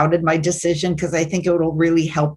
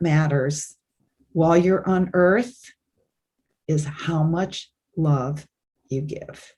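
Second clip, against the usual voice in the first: slow, fading softer to near silence; solemn, gentle; 3 dB quieter, slower than usual. Retold or imitated. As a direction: deliver it like a sacred truth. Being of light's final words.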